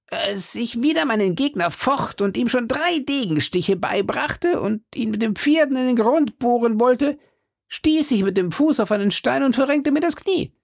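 very high, boastful musketeer voice; Porthos. Acted. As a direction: brag of a duel, pitched far up. Porthos boasts of his duel.

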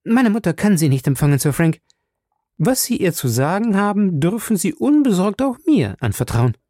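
put-on young lady retorting sharply; sharp reply. A narrator's reading. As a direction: cut him off sharply. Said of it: "gab die junge Dame scharf zurück".

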